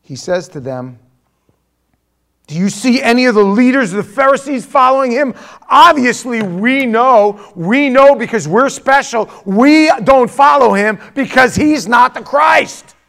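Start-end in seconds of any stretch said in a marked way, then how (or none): 2.5-12.9 raised, loud and indignant; haughty rebuke. Acted. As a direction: declare it as a self-important religious leader. Pharisee haughtily rebukes Nicodemus.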